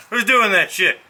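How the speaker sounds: loud, high, sudden yell at the noise; startled call-out. Real, not acted. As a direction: shout out at whoever is making that racket.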